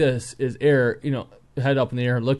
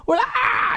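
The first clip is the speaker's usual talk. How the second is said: playful scream. Retold or imitated. Dad sneaking up behind his kid, playful mock scream. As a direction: let out a playful high shriek. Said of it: "I'll scream behind him".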